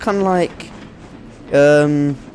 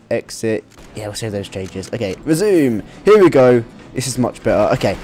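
ordinary delivery

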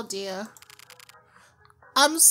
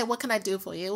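quiet retold shop greeting; 6 semitones lower, 11 dB quieter, much faster than usual. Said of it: "I was about to say".

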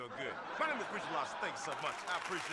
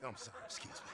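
whispering; 6 semitones lower, 7 dB quieter than usual. Acted. Squeezing past passengers on plane.

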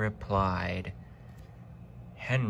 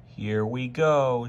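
slow, louder resigned mutter; bracing; 3 semitones higher, 5 dB louder than usual. A narrator's reading. Driver seeing the amber light again, wary. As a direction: brace for trouble, drawn out.

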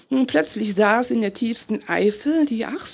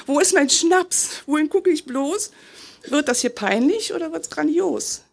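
ordinary delivery

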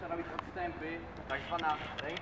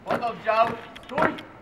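drill command; 5 semitones higher, 10 dB louder, much slower than usual. Real, not acted.

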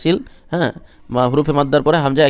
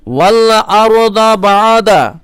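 higher, louder, melodic tilawat; Quran recitation; 5 semitones higher, 8 dB louder, much slower than usual. Acted. Teacher recites the verse for students in tilawat. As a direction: lift the verse into a full chant.

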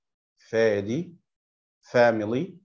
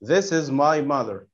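slow model reading; much slower than usual. Shown as a demonstration. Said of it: "teacher models slowly".

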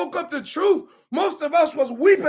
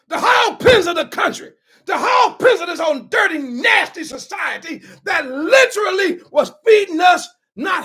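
preaching at full force; 4 dB louder than usual. Acted. Preaching building to climax.

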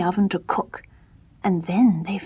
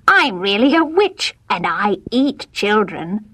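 witch voice. Acted. Witch reveals herself.

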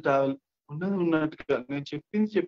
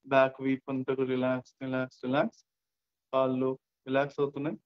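even, unhurried relaxation voice with long pauses; guided meditation; 2 semitones lower than usual. Acted. Guide listeners to relax from shoulders to feet, guided meditation. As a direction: ease them into rest, one body part at a time.

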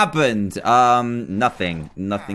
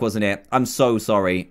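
ordinary delivery

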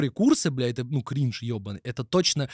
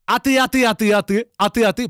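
high, louder whiny mimicry of a childish comeback; mocking taunt; 6 semitones higher, 5 dB louder than usual. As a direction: throw it back like a schoolyard tease. Mocking reply to opponent. (дразнилка «а ты», выше и громче)

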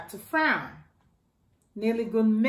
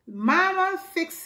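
calling mama; 8 semitones higher than usual. Acted. Child calls to mama.

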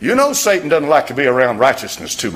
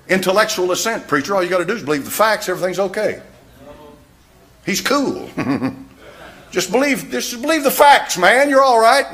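hammed-up breezy skeptic, much higher; mock the glib know-it-all, pitched up high; mock objector; 8 semitones higher, 4 dB louder than usual. Preacher mocks an objector telling him it's all fine, hammed-up and high. Mocking an easy-believism objector.

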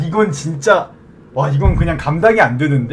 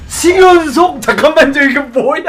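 stunned disbelief; 8 semitones higher than usual. Real, not acted. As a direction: freak out at the streak. Seventh success in a row.

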